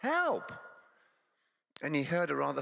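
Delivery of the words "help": comic mock panic, pitched up; panicked shouting. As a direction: yell to be let out, fast and frantic. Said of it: "started shouting".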